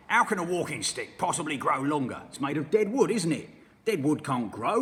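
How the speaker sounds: sneering husband, loud; mean old husband taunting his wife; Mr Twit. Acted. Mr Twit's dialogue.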